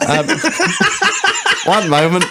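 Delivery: pompous lord who can't keep a straight face; posh toff through laughter. Pretend. Host plays a snobbish Range Rover toff, laughing.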